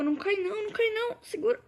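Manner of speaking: high, loud panicked plea; panicked shout. Real, not acted. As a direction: yell for it not to fall. Block about to fall.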